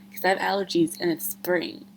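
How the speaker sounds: normal talking voice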